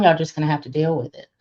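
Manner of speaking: ordinary delivery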